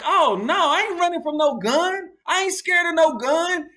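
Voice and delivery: high-pitched, swaggering refusal to run; cocky bravado. Retold or imitated. Young driver boasting to friends about the gun, cocky. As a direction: brag that you fear nothing. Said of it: "I was like".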